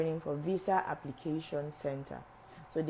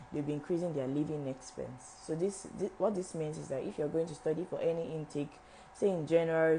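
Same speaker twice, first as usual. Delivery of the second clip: normal talking voice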